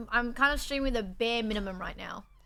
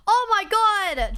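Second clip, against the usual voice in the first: loud gasp; 8 semitones higher, 7 dB louder, much slower than usual. Real, not acted. Excited outburst about athletics.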